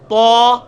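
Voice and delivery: تو as a thick, full-mouthed taw, loud and held; long booming تو with a heavy t; heavy emphatic t. Shown as a demonstration. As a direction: thicken the t of تو, no w glide. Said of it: "اسی کو موٹا کر دیں".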